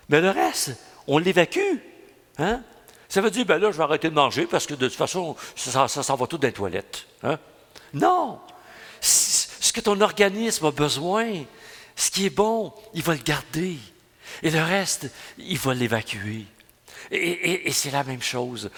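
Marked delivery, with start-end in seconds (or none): none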